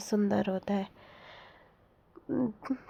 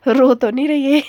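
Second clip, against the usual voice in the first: higher, much louder teasing jab; raised-voice teasing before a laugh; loud teasing question; 4 semitones higher, 10 dB louder than usual. Retold or imitated. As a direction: tease them loudly, about to burst out laughing. Teasing someone about crying, then laughs.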